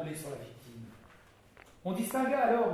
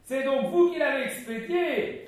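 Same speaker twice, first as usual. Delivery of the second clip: much higher, louder, slow thrilled cry; ecstatic cry; 9 semitones higher, 9 dB louder than usual. Acted. Innkeeper crying out in ecstasy at Ben's deed. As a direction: gush with rapture. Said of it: "cria la Hurière en extase".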